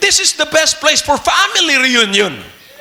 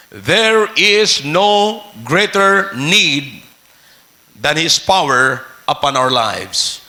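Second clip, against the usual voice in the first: slow, weighty; 8 semitones lower, much slower than usual. Real, not acted. Preacher telling the church its greatest need, very slow. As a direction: drag each word out, low and weighty.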